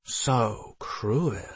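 soft, drawn-out creature tease; teasing. A narrator's reading. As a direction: needle her gently in the familiar's voice. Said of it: "he teased".

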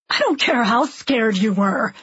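irritated, dismissive retort; heated but not shouted; angry. A narrator's reading. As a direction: cut off the excuse with contained anger. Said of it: "angrier at me than I've ever seen him".

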